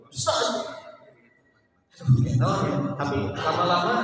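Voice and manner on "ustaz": loud call. Retold or imitated. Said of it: "asli si bapak ya".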